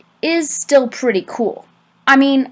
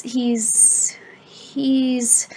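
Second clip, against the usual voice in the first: 1 stuttering whisper; 2 semitones higher, 7 dB quieter than usual. A narrator's reading. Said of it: "Mister P stuttered".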